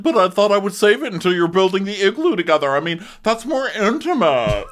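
put-on high, loud, fast valley girl voice; hammed-up airy excuse; mock sarcastic girl. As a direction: [name] excusing herself to Gabby, high and fast. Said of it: "I'm just like".

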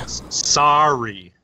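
sorry drawn out slow, stretched sah-ree. Shown as a demonstration. Trying out the American sorry, slow and drawn out. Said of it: "How do you say it?".

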